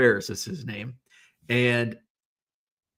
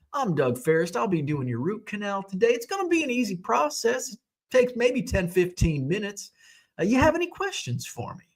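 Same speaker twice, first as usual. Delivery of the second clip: put-on higher-pitched, lilting Southern accent; Doug Ferris; 7 semitones higher, 4 dB quieter than usual. Endodontist talking a patient through the root canal, in an imitated Southern accent. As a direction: imitate a genial Southern gentleman with swooping pitch. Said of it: "like, Southern accent".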